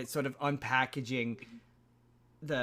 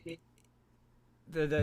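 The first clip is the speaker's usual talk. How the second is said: normal talking voice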